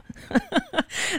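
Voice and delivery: high, soft burst of laughter. Real, not acted. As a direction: laugh at your own understatement. Laughs after naming the problem.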